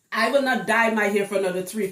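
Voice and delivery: ordinary talk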